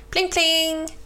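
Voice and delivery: high ringing bell imitation; bell pling. Narrator imitating the cow's bicycle bell, high and ringing. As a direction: chime it out like a little bell.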